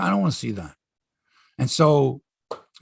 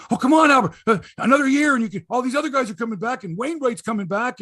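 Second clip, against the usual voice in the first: coaxing; 6 semitones higher, 3 dB louder, faster than usual. Retold or imitated. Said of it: "people are going".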